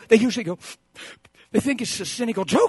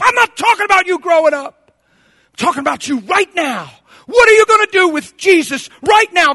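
loud, raised-pitch rebuke from the pulpit; full-force preaching; 10 semitones higher, 10 dB louder than usual. Real, not acted. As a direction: confront them with forceful preaching.

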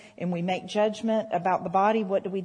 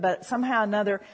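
ordinary talk, nothing marked

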